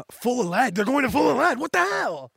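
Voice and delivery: high, loud acted outburst of disbelief; outraged shout. Sengoku reacting to news of Garp, high outraged shout. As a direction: shout it in rising alarm.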